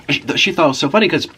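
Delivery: ordinary talk, nothing marked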